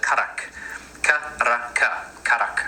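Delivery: "karak" with a short vowel on every letter. Shown as a demonstration. Teacher demonstrating the spelling, short-vowelled "karak". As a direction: sound out "karak" in short, even syllables. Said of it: "You vowel every letter, but it's like a short vowel".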